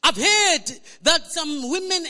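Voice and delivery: ordinary talk, nothing marked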